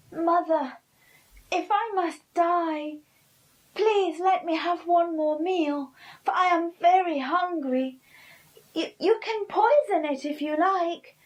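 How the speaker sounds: put-on high, loud boyish pleading; pleading Pinkle. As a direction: beg sweetly for one last meal. Pinkle begging for a meal.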